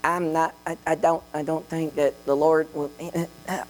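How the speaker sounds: halting mock stammer; stumbling, hammed-up self-doubt; insecure stammering. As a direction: act too unsure to finish the sentence. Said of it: "get a sentence out".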